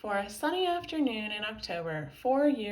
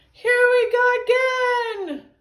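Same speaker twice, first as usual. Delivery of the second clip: high, slow, loud wail; drawn-out high-pitched yell; screaming; 13 dB louder than usual. A narrator's reading. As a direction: scream it out in dismay. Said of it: "screamed Harold".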